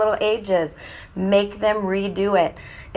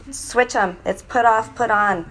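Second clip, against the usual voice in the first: ordinary talk, nothing marked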